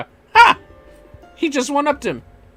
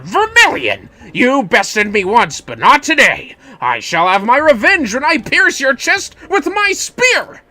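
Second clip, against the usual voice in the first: a hint of a pompous duelist vowing revenge; boastful rival; 6 semitones higher, 6 dB louder than usual. Voices the rival challenging the hero.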